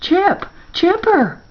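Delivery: high, urgent cry for a lost friend; horrified calling. A narrator's reading. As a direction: call out in alarm, pitch leaping up. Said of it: "horrified, at Chipper's disappearance and calls".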